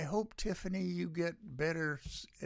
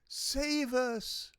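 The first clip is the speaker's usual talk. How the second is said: crowd cry; 11 semitones higher than usual. Retold or imitated.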